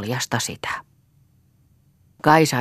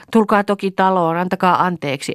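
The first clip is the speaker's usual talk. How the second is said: higher, louder inviting call; shouting back; 5 semitones higher, 3 dB louder than usual. A narrator's reading. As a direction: call back from afar to the guests. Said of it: "kääntyi takaisin ja huusi". (kova, korkea kutsuhuuto)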